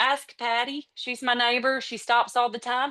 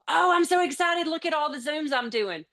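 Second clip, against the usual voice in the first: mock excited; 7 semitones higher than usual. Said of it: "if you're focusing on".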